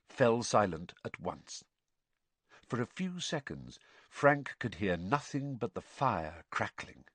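plain reading voice

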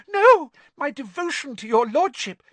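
very high, loud servant squeal; alarmed protest. A narrator's reading. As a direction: cut in desperately to plead loyalty. Servant protests his devotion in alarm.

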